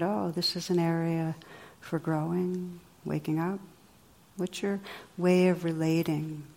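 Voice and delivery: soft, gentle meditation guidance; quiet reflective questions with lingering pauses; guided reflection. Real, not acted. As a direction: ease listeners inward, leaving long silences.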